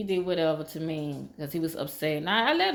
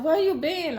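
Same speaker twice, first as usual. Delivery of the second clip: much higher, eager question; excited friend; 10 semitones higher, 4 dB louder than usual. Retold or imitated. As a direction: ask it all worked up.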